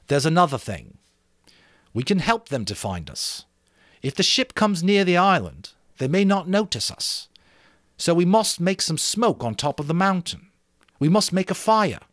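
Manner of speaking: rally the group with the plan; a boy chief sharing his plan with the assembly, eager; Ralph, eager and earnest. Acted. Ralph proposes the fire.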